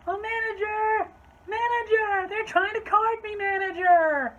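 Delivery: high, loud mock wail; hammed-up shrieky cry with stretched endings; yelling customer. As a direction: cut in hollering for backup. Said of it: "she's just yelling".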